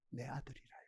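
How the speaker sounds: near-whisper, low and very soft; confiding preacher's hush; hushed answer. Real, not acted. Hushed answer to own question.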